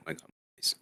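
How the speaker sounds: breathless whisper under her breath; whispered aside. Real, not acted. Reader fighting her throat mid-story, whispered plea. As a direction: mutter a plea while clearing the throat.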